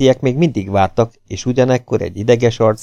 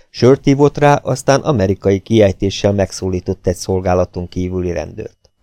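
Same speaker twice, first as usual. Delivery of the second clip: ordinary delivery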